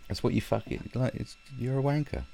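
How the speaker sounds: normal talking voice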